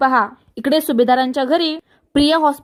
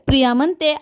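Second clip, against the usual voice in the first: usual speaking voice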